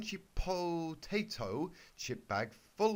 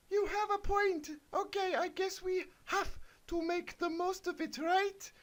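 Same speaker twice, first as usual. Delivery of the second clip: put-on much higher, squeaky girlish falsetto; squeaky Twilight voice. Twilight answers Big Mac in a high squeaky falsetto. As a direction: pitch way up into a silly cartoon squeak.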